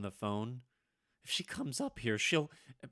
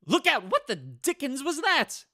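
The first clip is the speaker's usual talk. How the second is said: high, loud sudden yelp; startled shout; 14 semitones higher, 7 dB louder than usual. Acted. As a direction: jump at something in the dark. Startled by something flying.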